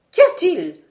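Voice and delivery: cri soudain, beaucoup plus haut et plus fort; cri alarmé. Acted. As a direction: lancer la question avec alarme. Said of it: "s'écria le docteur". (le docteur voit Fred congestionné, cri alarmé)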